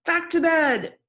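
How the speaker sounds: high, loud call of back to bed; shouting. A narrator's reading. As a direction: call it out loud across the house. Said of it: "yelled Ted".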